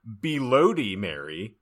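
normal talking voice

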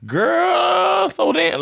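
high, drawn-out mock "girl"; put-on slang talk. Girlfriend imagines partner trying to be down with cousins, put-on slang talk. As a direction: exaggerate a try at sounding down. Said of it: "you started talking like that".

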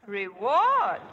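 a teasing doubtful Reward?; mock disbelief. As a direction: echo the word as if it were no prize at all. Star echoes the word, mocking it.

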